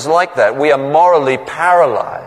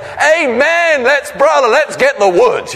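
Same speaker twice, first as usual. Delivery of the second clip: much higher, hammed-up joyful amen; mock jubilant; 10 semitones higher than usual. Preacher mocks an overeager believer, high and jubilant. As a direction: play a jumping, overeager believer. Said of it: "jumping up and down and saying".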